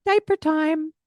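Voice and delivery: high, bright "diaper time"; cheerful statement. Shown as a demonstration. Parent scooping up baby, high cheerful announcement. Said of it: "Scoop them up and say".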